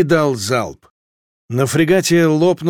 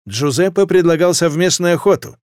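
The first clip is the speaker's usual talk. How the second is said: usual speaking voice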